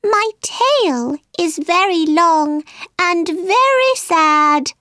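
high, woeful mouse voice; sighing sad mouse. A narrator's reading. Mouse telling Alice of its woe, high sad voice. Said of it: "turned to Alice and sighed".

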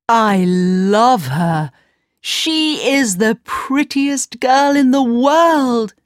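slow, high, lovestruck prince; dreamy young prince voice. Acted.

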